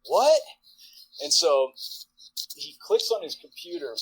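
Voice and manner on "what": sharp high loud what; stunned what, shouted up high; incredulous. Retold or imitated. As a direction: bark it out in sudden disbelief. Disbelief at the band house.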